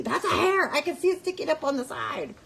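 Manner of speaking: mocking commenters. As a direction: play the know-it-all viewer. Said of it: "people were, like, fighting me".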